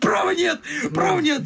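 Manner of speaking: high, pleading protest; agitated protest. Acted. Young man protesting to his uncle, high and agitated. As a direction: throw up your hands, insisting.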